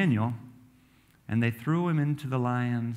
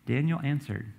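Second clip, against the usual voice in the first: ordinary delivery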